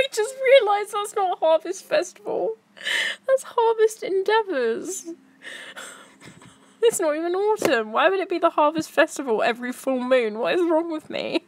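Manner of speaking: high giggly voice through laughter; can't stop laughing at yourself; real helpless giggles. Real, not acted. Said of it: "I don't know why I'm laughing so much".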